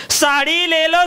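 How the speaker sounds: high, loud hawker calling out saree for sale; street hawker call. Retold or imitated. Hawker outside the house calling out loud to sell sarees. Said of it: "बाहेरून आवाज दिला".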